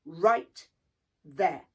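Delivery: shouting. A narrator's reading. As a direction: bark the order to halt. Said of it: "Anansi shouted".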